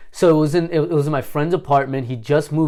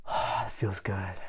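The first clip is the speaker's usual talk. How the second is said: softer and slow, savoring the air; soft relief; 6 semitones lower, 15 dB quieter, slower than usual. Retold or imitated. Relieved at the window after the panic, softer and slow. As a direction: murmur it like the breeze just saved you.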